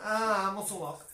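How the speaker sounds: high, loud cry of pain; loud strained yelp; taser jolt. Real, not acted. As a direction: yelp as the muscle seizes up. Reacting to being zapped by taser.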